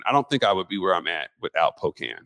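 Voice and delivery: ordinary delivery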